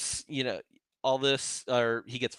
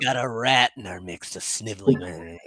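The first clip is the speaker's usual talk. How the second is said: lower-pitched rat impression trailing into a snivel; Jack Nicholson; 5 semitones lower than usual. Host imitating the Departed rat scene, put-on sniveling voice. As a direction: act out a disgusted gangster sniffing out a rat. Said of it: "And he's like".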